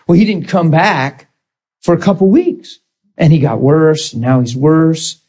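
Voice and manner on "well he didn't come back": ordinary talk, nothing marked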